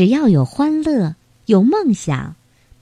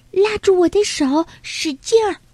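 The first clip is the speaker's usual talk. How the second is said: light high call of a small animal urging a friend on; 焦急呼喊的小动物; 10 semitones higher than usual. Acted. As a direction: hurry him across, voice lifted. Character reaches for his hand. (略带焦急的高声呼唤)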